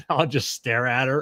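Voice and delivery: ordinary talk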